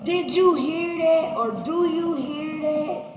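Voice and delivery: high, loud urgent question; God asking loudly. Retold or imitated. God asking Cain about the blood, loud and high. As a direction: demand it, as God pointing at the sound. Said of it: "Some translations say".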